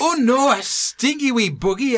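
mock Scottish gran fussing. Host plays the granny reacting to the smell in Scottish. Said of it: "in her Scottish accent".

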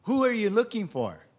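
loud, high, ringing demand; retold challenge, projected strongly; commanding question. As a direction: confront them boldly with full voice. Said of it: "Jesus asked them".

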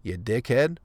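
ordinary talk

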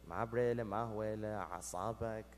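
low, subdued aside to his son; quiet aside. Retold or imitated. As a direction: drop it low and soft, confiding. Father's muttered aside to his son.

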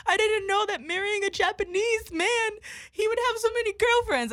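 put-on high, strained voice of a crying drunk stranger; high tearful drunk mom. Retelling a drunk stranger crying about her husband, high tearful whine.

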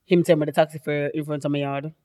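ordinary talk, nothing marked

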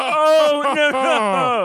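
high, mocking snob voice; snooty rich guy. Chip voiced in retelling.

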